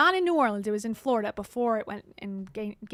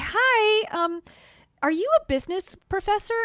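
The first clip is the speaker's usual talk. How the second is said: high, bright, loud opener to a stranger; eager student reporter; 8 semitones higher, 6 dB louder than usual. Retold or imitated. A student reporter asks a professor for a quote, eager and high. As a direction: play a nervous young reporter knocking on office doors. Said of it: "and say".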